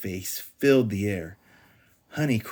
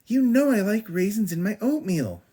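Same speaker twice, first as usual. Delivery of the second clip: demanding whine; 12 semitones higher, 5 dB louder than usual. A narrator's reading. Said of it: "she demanded".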